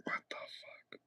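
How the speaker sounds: soft whispered what the fuck in disbelief; stunned whisper. Real, not acted. Streamer reading a dumb reply in chat, whispered. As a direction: breathe it out, barely voiced.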